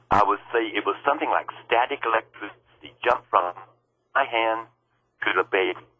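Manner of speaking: ordinary delivery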